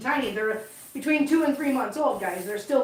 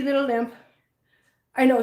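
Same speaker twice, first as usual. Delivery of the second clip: usual speaking voice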